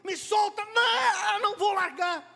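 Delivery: high, effortful cries of struggle; straining fight shouts. Acted. Jacob and angel fighting, straining shouts. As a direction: shout as if locked in a wrestling hold. Acting out the wrestling match.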